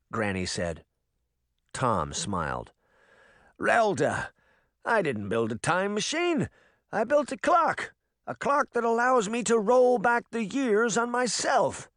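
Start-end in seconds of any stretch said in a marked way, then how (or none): 3.6-11.9 put-on old man voice. A narrator's reading. Tom voiced as character.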